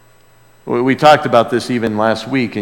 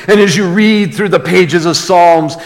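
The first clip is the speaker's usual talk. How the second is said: ordinary talk, nothing marked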